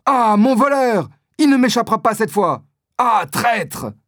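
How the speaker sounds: cri aigu et fort, lent au début; en criant. A narrator's reading. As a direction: crier après le voleur qui s'enfuit. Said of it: "il part en criant". (D'Artagnan crie en courant après l'homme, voix haute)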